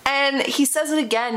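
ordinary talk

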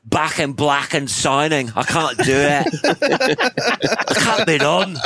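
Irish rocker complaining, climbing in pitch; hammed-up garbled Irish accent; drowning Irish accent. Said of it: "sounds like an Irish guy drowning".

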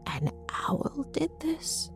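slow, whispery gasp of disbelief. A narrator's reading. Mother learning of the owl attack, gasped. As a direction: breathe it out, soft and aghast. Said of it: "Mom gasped".

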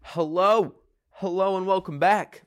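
high, bright host greeting; hammed-up host voice; TV host welcome. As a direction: welcome the audience back like a show host. Host returns from break.

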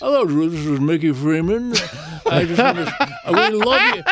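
put-on Mickey putting on an English accent, with a laugh; plummy English accent, laughing through it; fake English accent. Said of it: "all of a sudden he had this English accent".

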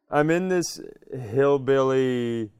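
usual speaking voice

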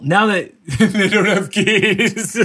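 high voice breaking into laughter; real laugh through the words. Real, not acted. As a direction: crack up while talking. Cracking up at the joke.

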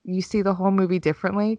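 ordinary delivery

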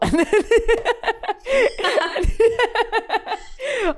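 high-pitched helpless cackle; long high laugh. Real, not acted. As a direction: burst out laughing at your own remark. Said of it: "I'm so sorry".

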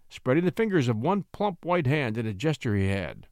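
usual speaking voice

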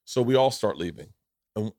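ordinary delivery

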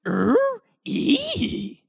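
very high cartoon impression voice; Scooby-Doo. Shown as a demonstration. Host shows off his one sentence, Scooby-Doo impression. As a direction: squeal it out like a cartoon, ending in odd noises. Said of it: "I can do a Scooby-Doo sentence".

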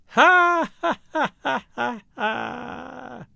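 high mocking "ha ha", fading away; eerie laugh trailing to nothing; vanishing laughter. A narrator's reading. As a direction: laugh high, then die off into the distance. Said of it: "Somewhere a vanishing laughter".

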